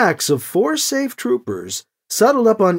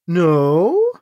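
long, slowly stretched word; drawn-out no; 3 dB louder than usual. Acted. Alibi answering Krish's question, drawn out. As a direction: draw the word right out. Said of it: "Alibi drew the word out".

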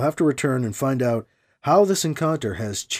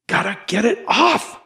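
acted desperation, strained voice; desperate strain; 3 semitones higher than usual. A narrator's reading. Hero cutting the tentacle from his leg, acted desperation. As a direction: strain to get free.

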